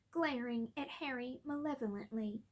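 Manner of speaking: ordinary delivery, nothing marked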